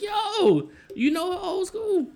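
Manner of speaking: very high incredulous question; retold shock. Host questioning old school about a woman, shocked and high. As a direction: pitch it way up in scandalised disbelief.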